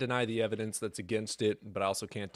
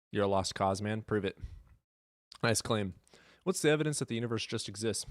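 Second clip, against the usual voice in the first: usual speaking voice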